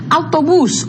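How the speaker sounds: "Autobús" with the weight on final bús; stress on last syllable. Shown as a demonstration. Teacher modelling an aguda word for students, stressing bús in "Autobús". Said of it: "la sílaba tónica es la última".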